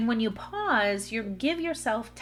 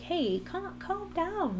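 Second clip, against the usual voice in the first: higher-pitched, gentle imitation of a husband soothing; tender, lightly voiced "calm down"; loving reassurance; 8 semitones higher, 4 dB quieter, slower than usual. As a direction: imitate someone lovingly talking a partner down. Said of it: "like, lovingly".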